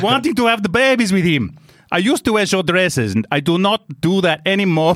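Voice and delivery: high, mock-indignant German-sounding accent; accent slipping German. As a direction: act the wife in botched Italian turned German. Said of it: "now I'm German".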